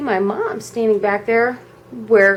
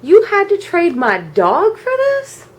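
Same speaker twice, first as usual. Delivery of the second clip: acted-out dismayed outrage; incredulous protest; 3 semitones higher, 4 dB louder, slower than usual. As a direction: protest like a wronged kid, stretching the key words. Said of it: "And I'm thinking".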